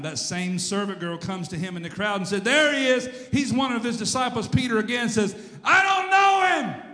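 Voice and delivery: loud, high dramatic Bible storytelling; preacher acting out an emphatic denial; full-force preaching. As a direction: build to a high shouted denial.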